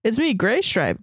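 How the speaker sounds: ordinary talk, nothing marked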